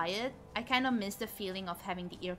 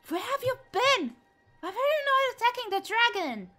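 much higher, louder indignant scolding; high exasperated scolding; 13 semitones higher, 8 dB louder than usual. Real, not acted. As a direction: scold the follower in disbelief. Scolding absent follower.

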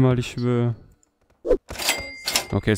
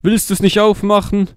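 high-pitched playful question; high teasing voice; 9 semitones higher, 3 dB louder than usual. Pretend. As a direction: tease it up high. Teasing pretend mom.

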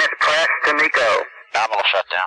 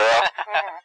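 short laugh; 7 semitones higher than usual. Real, not acted. Laugh after joke about switches.

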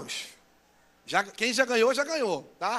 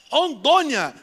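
loud excited repeat of the place; excited call; 4 semitones higher, 10 dB louder, much slower than usual. Real, not acted. Pastor echoes where the visitor came from, loudly excited. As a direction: shout the name back to the crowd.

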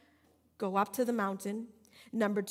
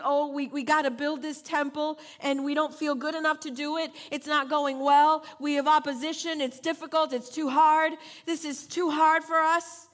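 preaching complaint; 3 semitones higher, 6 dB louder than usual. Acted. Voicing the people's complaint.

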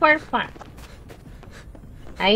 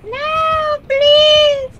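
desperate plea; 6 dB louder than usual. Real, not acted. Begging as he is caught.

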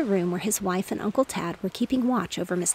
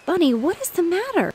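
high, raised mother's call; 10 semitones higher, 5 dB louder than usual. A narrator's reading. Mother sees her son reeling about, calling out. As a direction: call out to the child, pitched well up. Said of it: "called his mother".

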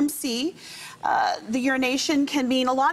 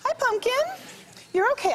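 soothing a scared dog; 12 semitones higher than usual. Real, not acted. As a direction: coax the frightened dog gently. Vet calming scared dog.

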